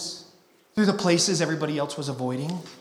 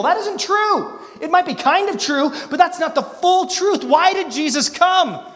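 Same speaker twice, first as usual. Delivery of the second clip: rising to a loud, emphatic peak; raised preaching voice; 10 semitones higher, 10 dB louder than usual. Real, not acted. Preacher to the congregation, raising his voice. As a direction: drive it home to the congregation.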